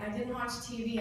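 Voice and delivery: usual speaking voice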